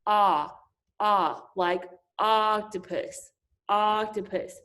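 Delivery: short O said as ah, as in octopus; short O sound. Shown as a demonstration. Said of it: "My short sound for O is".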